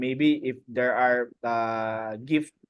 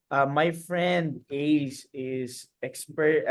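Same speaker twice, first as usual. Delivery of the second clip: ordinary talk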